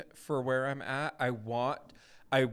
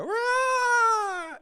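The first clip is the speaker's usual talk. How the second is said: mock high, loud drawn-out "raaah"; theatrical wail; 10 dB louder than usual. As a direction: overdo it like a hammy audiobook dramatization. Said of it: "I really don't like the dramatization".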